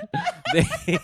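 very high, shrieking cackle; maniacal laugh. Retold or imitated. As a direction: cackle like a madman. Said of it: "They laugh maniacally about this".

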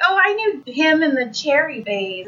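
mock reminiscing. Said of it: "and you'd be like".

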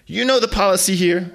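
loud, high lecture; mother reciting the family policy; stern mom. Acted. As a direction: lay down the house rule.

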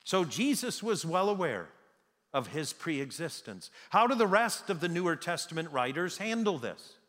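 usual speaking voice

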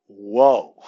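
loud, drawn-out whoa of amazement; amazed whoa. Retold or imitated. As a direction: let the whoa fall, impressed. Amazed watching players run court.

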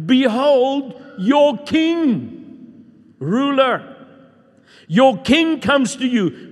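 high, loud, slow proclamation; preaching. Acted. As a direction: proclaim the scripture like a herald. Reads prophecy with preaching force.

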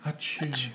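a hushed, muffled "achoo"; soft sneeze. Shown as a demonstration. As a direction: do a gentle ASMR sneeze. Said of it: "a real soft, soft one".

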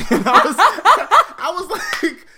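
loud high laughter; laughing. Real, not acted. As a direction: laugh through it loudly. Laughing at funny part.

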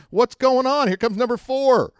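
high, loud cry of dismay; mock alarm. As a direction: wail it in hammed-up panic. Acted dismay at more bad luck.

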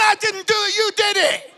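high-pitched defensive whine of denial; imitating a son in a shouting match; protesting son. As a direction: throw the blame straight back. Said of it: "And then he's going".

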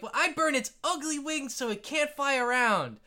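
put-on very high, squeaky-kid declaration; kid player. Another kid declaring a dragon attack, high child voice. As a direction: pitch it way up like an eager child. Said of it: "the other kid is like".

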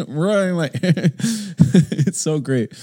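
high, giggly real laughter through the words; amused, words bubbling with laughter; laughing delight. Real, not acted. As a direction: break up laughing as you say it. Laughing at the shared lateness.

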